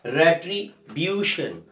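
"Retribution" slowed, broken before a stressed BU. Shown as a demonstration. Teacher demonstrating the second last syllable, slow stressed "retriBUtion". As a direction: lean on the BU in "Retribution". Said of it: "We are stressing on the second last syllable".

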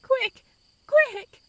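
high, urgent frightened cry. Acted. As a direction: cry out in terror for help. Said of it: "she cried".

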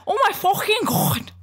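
high-pitched exaggerated Scouse imitation; mock Scouse accent. Showing co-host the Scouse slant, mock Scouse accent. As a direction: ham up Scouse vowels. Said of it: "even the Scousers have a little bit of".

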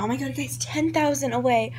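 ordinary talk

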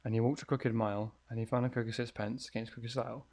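hushed spooky rhyme recital; reading a rhyme. Acted. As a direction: read the rhyme like a creepy note. Reads nursery rhyme from note.

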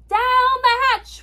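high, loud crew echo; joint shout. A narrator's reading. Crew shouts back at the dinner table, high loud shout. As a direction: shout back the captain's toast. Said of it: "we all shouted".

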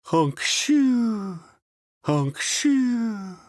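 high honk-shoo snore; snoring. A narrator's reading. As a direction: snore it out cartoon style. Doug falls asleep snoring.

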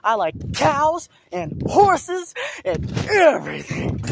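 high, slow words between bounces; on a trampoline. Real, not acted. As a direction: gasp out words mid-jump. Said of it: "That was a nice trip".

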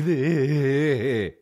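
high mock giggle; imitated laugh. Buddy laughing after music complaint, imitated giggle. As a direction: tail off in the buddy's silly giggle. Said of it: "He laughs at everything".

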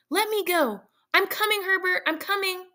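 high, urgent cry to her son; desperate calling. Acted. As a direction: call out to Herbert beyond the door. Mother calling to son outside.

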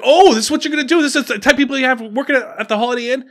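mock him with loud sneering disbelief; mocking outrage. Guest taunts the clerk in front of the camera, high and loud with scorn.

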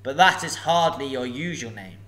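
a huge dragon toying with a thief; booming sly dragon; menacing dragon. Acted. Dragon speaks.

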